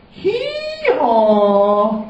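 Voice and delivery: loud high hee-haw bray; donkey bray. Shown as a demonstration. Storyteller showing children the donkey call. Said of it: "I'd like you to all go".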